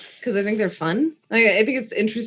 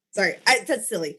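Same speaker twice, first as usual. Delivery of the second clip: usual speaking voice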